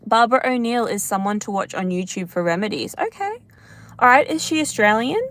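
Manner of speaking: ordinary talk, nothing marked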